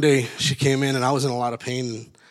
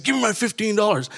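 retold angry demand; 8 semitones higher than usual. Gunman demanding parking money from other guy, mock angry. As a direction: act out a heated demand. Acted gunpoint demand in story.

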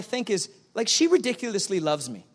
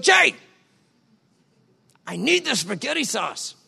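wife calling; 4 semitones higher than usual. Retold or imitated. Wife asking husband for sauce, calling his name. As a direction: call his name up high. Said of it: "when she's like".

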